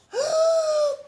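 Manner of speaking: sharp, high, loud gasp of fright; startled gasp. Acted. The bear seeing his own reflection, sharp frightened gasp. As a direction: gasp like you just saw a monster.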